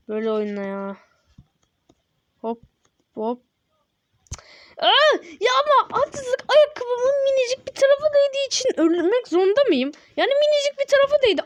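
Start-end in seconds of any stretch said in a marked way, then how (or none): 4.8-11.4 whiny protest. Real, not acted. Gamer protesting his shoe barely touched, high whine. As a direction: plead it's not fair, high up.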